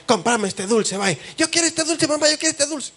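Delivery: put-on a child whining at mom for candy; whiny child tantrum. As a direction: beg for the candy like a pouting child. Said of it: "le hace puchero".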